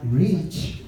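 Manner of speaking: low, slow affirmation; quiet confident confession; calm confession. Shown as a demonstration. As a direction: declare it slowly and low. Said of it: "we say".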